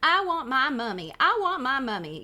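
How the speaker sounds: mock a little sister's whine with a twang; teasing big brother's sing-song taunt; Derek mimicking babyish Susie. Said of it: "Derek chants, imitating Susie's babyish voice".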